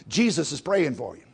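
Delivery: ordinary talk, nothing marked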